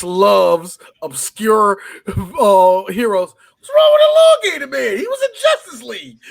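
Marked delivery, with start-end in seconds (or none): 3.6-6.2 high, loud mock outrage; outraged fan protest. Imitating Chris defending an obscure hero, high loud outrage. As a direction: squeal it in indignant defense of an underdog hero. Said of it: "people like Chris".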